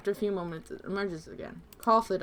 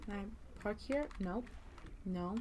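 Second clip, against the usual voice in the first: much softer, mumbling to yourself; muttering; 4 semitones lower, 18 dB quieter than usual. Real, not acted. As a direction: talk under your breath. Muttering to self while playing.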